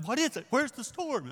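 high, loud flustered yelp; mock panic. Disciple panicking as he wakes Jesus, high flustered yelp. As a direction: play the frantic disciple jolting awake. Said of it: "Panickers would jump up".

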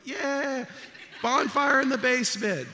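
high, loud gleeful cheer with a laugh; excited kid cheer. Retold or imitated. Friends egging him on toward a basement bonfire. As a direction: cheer like reckless kids. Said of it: "All my friends were like".

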